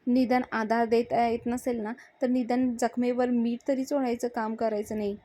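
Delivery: usual speaking voice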